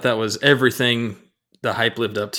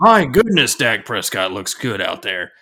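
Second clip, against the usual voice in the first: Chris Collinsworth; slower than usual. Retold or imitated. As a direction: imitate an admiring broadcaster, easing into a drawl. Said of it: "I heard him say".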